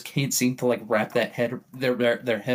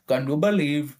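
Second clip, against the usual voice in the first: hammed-up Indian accent TikTok voiceover, higher; mock Indian accent; 3 semitones higher, 3 dB louder than usual. Joking about hiring Indians for voiceovers, hammed-up Indian accent. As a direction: parody an Indian accent narrator, climbing high at the end. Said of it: "there has to be an Indian TikTok voice".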